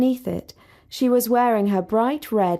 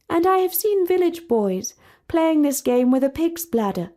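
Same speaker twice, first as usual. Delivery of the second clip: higher, regal and earnest; lightly voiced princess; lofty princess; 7 semitones higher than usual. A narrator's reading. Princess telling Sally of village games, lofty.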